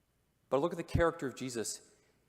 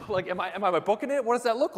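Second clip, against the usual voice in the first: high, loud, hurried mock alarm; half-laughing, playing a leper fleeing the wind; mock panic; 8 semitones higher, 9 dB louder, faster than usual. Preacher mimics a leper bolting from the breeze, high and loud.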